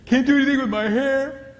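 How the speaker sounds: high, mock-wailing wife; distressed wife. Wife complaining to her husband about her weight, high mock wail. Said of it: "comes to her husband and says".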